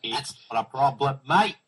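put-on a shaky Australian accent drifting British; bad Australian accent. Guest attempts an Australian accent to join the Aussie hosts. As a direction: try an Aussie accent and land halfway to British. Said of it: "you don't sound Australian when you try".

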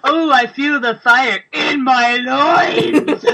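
slow, laughing, hammed-up passion; mock romance swoon. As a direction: ham up a swooning lover through laughter. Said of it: "feel it in your loins".

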